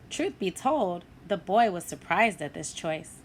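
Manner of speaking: plain reading voice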